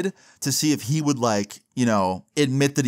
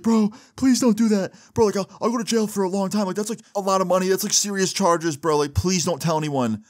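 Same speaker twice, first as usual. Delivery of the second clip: high, teary begging; crying plea; 8 semitones higher than usual. Retold or imitated. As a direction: beg through tears. Thief begging while crying.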